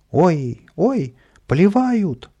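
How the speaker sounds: high, loud, drawn-out outcry; вскрик толпы. A narrator's reading. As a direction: yelp like startled passers-by. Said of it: "закричали люди на улице". (громкий высокий испуганный вскрик)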